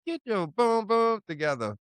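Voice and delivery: high-pitched grandmotherly scolding; imitated grandmother. Grandmother telling the speaker to get it together, imitated high scolding. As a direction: imitate her laying down the law, high. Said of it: "I heard her loud and clear".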